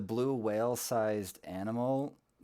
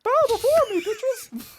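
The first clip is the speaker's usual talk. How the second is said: shrieking, much higher and louder command; dark lord; 11 dB louder than usual. Pretend. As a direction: shriek it like a villain god. Mock deity commanding worship.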